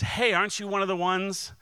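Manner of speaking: high-pitched, acted challenge; accusing servant. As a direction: call out a suspect you half recognize. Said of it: "this servant comes up and says".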